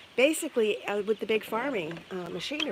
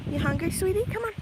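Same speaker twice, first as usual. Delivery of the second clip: coaxing a hen; 11 semitones higher, faster than usual. Real, not acted.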